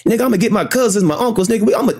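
high-pitched, indignant tirade; acted outrage. Showing how the woman should have responded, outraged. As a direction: rant in a high voice as if wronged. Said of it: "You should be pissed the fuck off".

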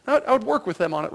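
ordinary talk, nothing marked

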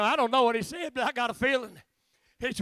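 normal talking voice

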